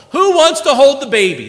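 loud, high parent asking for volunteers; eager call-out. Retold or imitated. Parent asking the children who will hold the baby, excited call. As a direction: call it out brightly to the whole household.

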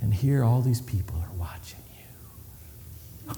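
hushed whisper. Real, not acted. Preacher whispers that everyone is watching, hushed. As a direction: let them lean in to hear. Hushed aside about onlookers.

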